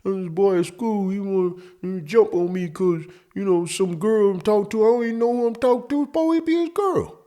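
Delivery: put-on high, whiny kid voice; whining kid. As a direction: whine it out like a scared boy to mom. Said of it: "pacing and whining and mumbling".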